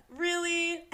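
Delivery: sassy disbelief. Retold or imitated. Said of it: "and he's like".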